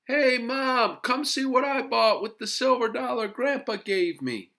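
put-on high, loud boy yelling for Mom; full-voiced, high-pitched call; excited yell. A narrator's reading. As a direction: holler to Mom through the house. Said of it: "opened the door and yelled".

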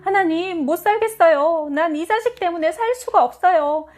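high-pitched desperate wailing plea; crying out to God. Acted. Mother at dawn prayer complaining about her son, crying out. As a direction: wail your complaint up to God. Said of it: "울며 불며 하나님께 부르짖었다".

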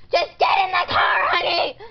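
loud, fed-up mom yell; loud, impatient command; exasperated shout. Acted. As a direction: shout the order at a complaining child. Exasperated mother orders child in.

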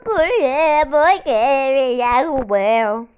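loud, slow indignant complaint; loud outraged protest. Real, not acted. Gamer knocked over by another player, loud outraged protest. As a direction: yell why they knocked you down.

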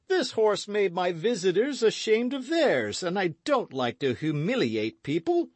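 high, hearty, booming host; boisterous Porthos. A narrator's reading. Porthos welcoming friends to dinner, boisterous. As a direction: play an expansive, boastful big musketeer.

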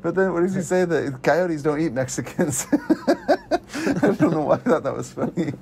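giggling at his own joke; laughing. Real, not acted. Laughing at a film line.

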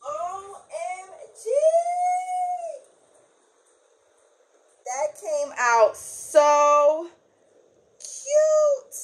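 high, drawn-out delighted gushing; gushing makeover reveal. Real, not acted. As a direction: stretch it out in awe. Gushing over the result.

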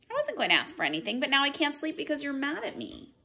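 lively storybook read-aloud with character voices; picture-book storytime voice; reading to children. Acted. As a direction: read it to a class of little ones. Teacher reads picture book aloud.

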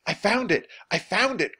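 high, loud discovery; excited cry. A narrator's reading. Jack discovers the chest, crying out excitedly. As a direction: exclaim it like a treasure find. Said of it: "cried Jack".

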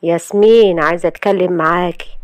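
usual speaking voice

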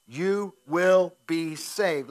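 loud and slow, leaning on every word; emphatic promise. Acted. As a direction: land the promise with weight. Preacher stresses scripture promise.

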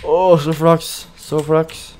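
lucky-break exclamation; relieved exclaim. Real, not acted. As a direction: gasp out your luck. Relief after a lucky escape.

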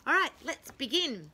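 ordinary delivery

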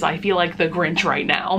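usual speaking voice

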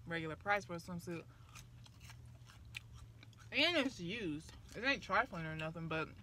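speaking between bites; talking while chewing. Real, not acted. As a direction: mouth partly full of food. Seen 1: puts food in mouth at 1.0s, chews between phrases throughout.

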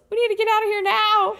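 high, loud frantic yell; loud panicked escape yell; Zach yelling. Acted. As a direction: yell it while grabbing her to flee. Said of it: "Zach grabs Emily and yells".

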